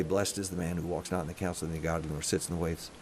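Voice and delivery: low, soft, rapid-fire mumble; muttered recitation. Shown as a demonstration. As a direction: mutter it fast under your breath like a memorized verse. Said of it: "You're muttering it all of the time".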